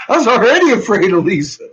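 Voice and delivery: loud, laughing outburst about the bully; laughing shout. Real, not acted.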